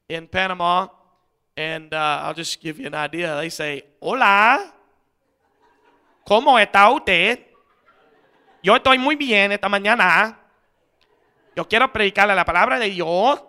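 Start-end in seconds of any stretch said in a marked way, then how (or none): 4.0-13.4 mock Chinese-accented Spanish. Preacher imitating Panama's Chinese speakers, loud mock Chinese-accented Spanish. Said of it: "that's how they speak Spanish".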